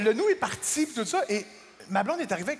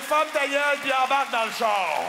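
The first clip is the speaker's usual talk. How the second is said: bossy bark; 6 semitones higher, 4 dB louder than usual. Retold or imitated. Translating her meaning bluntly.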